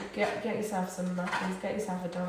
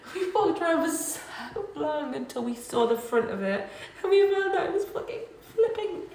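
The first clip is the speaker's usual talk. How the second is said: laughing through the words; giggling while telling it; 7 semitones higher, 4 dB louder than usual. Real, not acted. Funny story of closed club.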